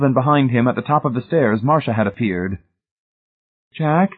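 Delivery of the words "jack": higher-pitched, tentative questioning call; hesitant call. A narrator's reading. Wife calling to her husband from the top of the stairs, hesitant. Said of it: "she called hesitantly".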